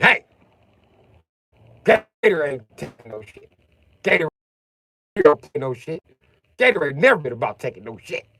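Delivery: high, loud mock-tough boast; tough-guy movie quote. Quoting Will Ferrell's character to his wife, a loud mock-tough boast. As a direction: puff up like a fake gangster. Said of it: "He said".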